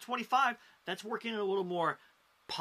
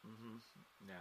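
very quiet, low non-committal murmur; barely voiced imitation of a cold listener; unimpressed audience member; 7 semitones lower, 20 dB quieter than usual. Comic imitating the worst crowd with arms crossed, unimpressed murmur. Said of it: "sit there with their arms crossed".

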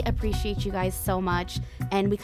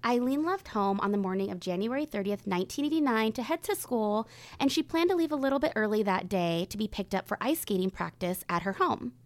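grave true crime narration; suspenseful crime show narrator. Acted. Reads the case introduction.

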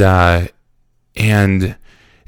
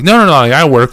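higher, louder hurried denial; flustered protest; 5 semitones higher, 5 dB louder, faster than usual. Retold or imitated. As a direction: act out protesting to an interrogator. Acted flustered denial to interrogator.

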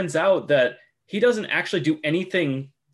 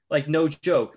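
normal talking voice

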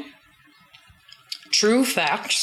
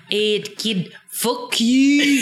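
nasty Scottish redcoat; 3 semitones higher, much slower than usual. Acted. Redcoat answering Fergus's speech, mock nasty retort. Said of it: "And he's like".

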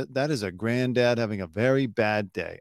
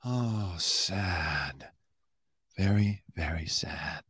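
softer, mock-mournful sigh of a voice; hammed-up sorrow, quieter; fake sadness; 4 semitones lower, 7 dB quieter, much slower than usual. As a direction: mimic a pundit's put-on grief. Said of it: "this fake".

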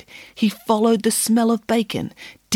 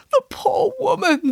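high, crying wail of pity; tearful cry. Acted. Boris the bear crying over the story, tearful wail. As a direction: bear sobbing over the poor woman.

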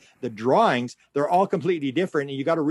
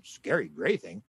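much lower, softer and slow, as if baffled; puzzled echo; 8 semitones lower, 6 dB quieter, slower than usual. Retold or imitated. The alien echoes her words, low, slow and puzzled. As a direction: repeat it back, low and bemused. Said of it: "He said".

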